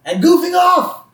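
high-pitched mock accusing boss; spring the insult in a put-on villain voice; Mister Burns. Boss turning on relieved worker, high hammed-up villain.

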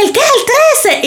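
very high, puzzled mishearing; confused old lady. Retold or imitated. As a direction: play a muddled old woman. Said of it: "Y ella dije".

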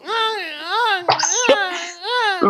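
high, hammed-up crying "ay, ay"; joking imitation of someone bawling; mock sobbing wails. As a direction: wail like an exaggerated crybaby. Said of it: "con los ruidos y todo".

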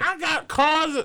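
high, loud boast; loud brag. Retold or imitated. Sister boasting she has a card, high loud boast. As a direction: shout it proudly. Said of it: "she's like".

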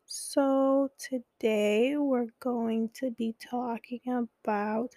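painfully slow, drawn-out therapist voice; very slow talk. Retold or imitated. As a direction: drag each word out like the dull therapist. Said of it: "literally at that pace".

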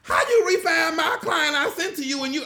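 hammed-up indignant shout through a laugh; mock outraged shout. Realtor mocking loan officers who took his client's refi, laughing mock shout.

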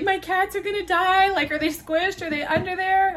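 high-pitched, loud frantic questions; freaking out. Retold or imitated. Woman fearing her cats got squashed, freaking out. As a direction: let panic push the voice way up. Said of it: "I'm, like, freaking out".

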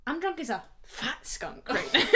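breathy giggling whisper; laughing whisper. Real, not acted. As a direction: giggle it out under your breath.

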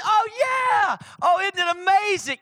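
high, shouted glee; excited God the Father. Acted. God cheering about Jesus, wildly excited. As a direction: whoop with delight, stretching the cheer. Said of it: "the Father getting so excited".

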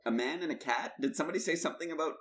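high-pitched, eagerly clueless listener; hammed-up high questioning; mock eager bluffer. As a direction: act like you've caught a scrap and are following. Acting out catching scraps of French.

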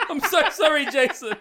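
sorry said through giggles; laughing apology. Real, not acted. Host apologising to listener Jason, giggling.

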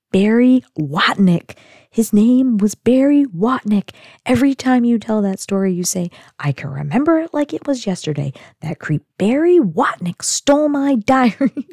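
loud, exasperated retort, punching the name; high, emphatic mock annoyance; imitating pissed-off teen. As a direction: act out a fed-up daughter throwing words back. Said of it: "so pissed".

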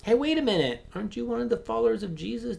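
put-on a sharp-tongued servant girl calling him out; accusing bystander. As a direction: point the finger at Peter, high and pushy.

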